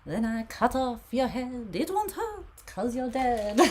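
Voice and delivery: high, singsong mock French chef; French chef accent. Reader joking about cake in a high French chef accent. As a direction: sing it like the cartoon French chef. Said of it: "My French accent comes from the chef".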